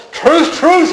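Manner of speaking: high ringing proclamation; proclaimed. Acted. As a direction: ring out the truth, truth. Voicing Jesus' opening words.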